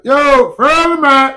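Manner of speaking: high, slow, loud call across the street; yelled greeting. Retold or imitated. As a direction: yell "Yo, Furley Mac" to a friend on a bike. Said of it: "just like that".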